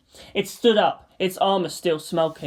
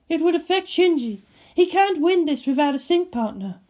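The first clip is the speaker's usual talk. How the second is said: higher woman's voice; character voice; 11 semitones higher than usual. Acted. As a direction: voice Ritsuko lightly. Voices Ritsuko.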